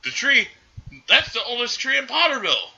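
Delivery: high-pitched indignant outburst, acted; freaked-out tree owner. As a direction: retell his outraged freak-out in a high voice. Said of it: "this guy freaked out again".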